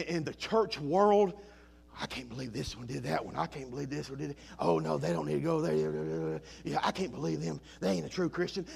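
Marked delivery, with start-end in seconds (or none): 2.0-8.7 low, soft and fast mock gossip chatter; gossiping churchgoer. Preacher mimics church gossips to the congregation, hushed fast mock chatter. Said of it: "have you ever heard so much gossip".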